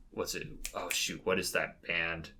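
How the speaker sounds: normal talking voice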